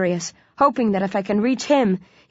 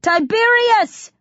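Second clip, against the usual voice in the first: long, high bellow of a name; 4 dB louder, slower than usual. A narrator's reading. Hero to old ally, bellowing. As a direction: bellow for help, drawn out. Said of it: "I bellow".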